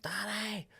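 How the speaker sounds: put-on high-pitched toddler calling for dad; imitated child squealing. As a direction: mimic a small kid squealing down the street.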